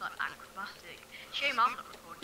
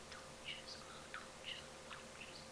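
whispering; 12 dB quieter, much slower than usual. Real, not acted. Whispers to himself.

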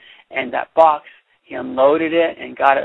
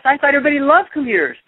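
1 puzzled surprise; 9 semitones higher, much faster than usual. Acted. Lewis puzzled by the booing.

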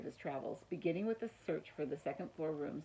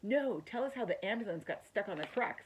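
put-on higher-pitched, insistent young cousin; eager clamouring; 5 semitones higher, 4 dB louder than usual. A narrator's reading.

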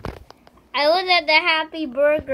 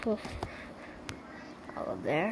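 whispered through laughter; 7 semitones lower, 14 dB quieter, much slower than usual. Real, not acted. Laughing aside.

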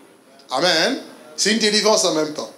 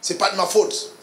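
mock blame-shifting. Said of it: "reporter la faute sur les autres".

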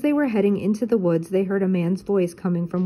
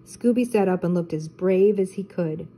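plain reading voice

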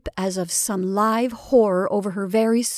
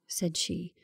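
ordinary delivery

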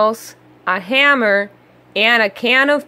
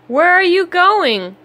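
cry out; 7 semitones higher than usual. A narrator's reading. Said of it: "cried Ruff".

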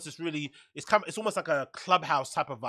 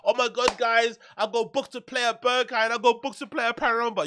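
imagined excited announcement; 7 semitones higher than usual. Pretend. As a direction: pretend thrilled, high-pitched brag. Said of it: "one day you're gonna hear me on this fucking pod say".

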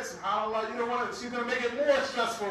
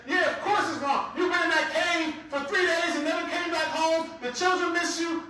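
higher, louder scolding complaint; mock complaining wife; 7 semitones higher, 3 dB louder than usual. Imagined wife scolds husband back from the cave, loud and higher. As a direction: nag him like a fed-up wife.

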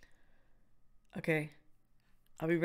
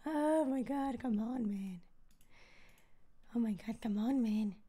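exasperated; 9 dB quieter than usual. Real, not acted. Waiting for release, still not out.

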